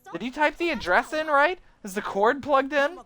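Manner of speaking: high, doubting questions; high questioning character. Acted. Influencer doubting the setup, high questioning. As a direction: quiz them on the setup.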